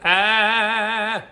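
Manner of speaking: high, loud, open "Ah!" with the nose open; open "Ah!" vowel shout. Shown as a demonstration. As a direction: belt out a bright, obnoxious "Ah!". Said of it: "obnoxious sound".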